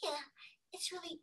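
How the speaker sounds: soft, breathy, high murmur; gentle character voice. Shown as a demonstration. Teacher showing an animated character before the switch, breathy gentle voice. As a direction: start airy and sweet. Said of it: "There's that contrast in there".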